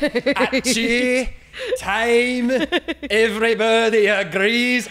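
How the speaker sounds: put-on laughing through it in a high, loud Scottish accent. Friends egging him on, Scottish-accented singing. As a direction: keep the Scottish accent going while cracking up. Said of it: "singing [name] songs with a Scottish accent".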